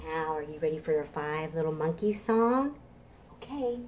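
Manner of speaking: ordinary delivery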